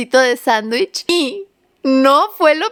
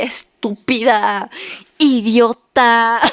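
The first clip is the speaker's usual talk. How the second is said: mock insults; slower than usual. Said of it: "No sé qué creyeron que yo le dije".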